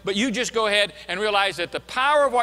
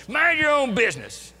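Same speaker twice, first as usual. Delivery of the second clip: mock angry retort, higher and a little louder; retold angry yell; 6 semitones higher, 3 dB louder than usual. Preacher retelling an irate stranger yelling at Moody, mock angry. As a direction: toss off the stranger's irate line half-acted. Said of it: "starts yelling at Moody and says".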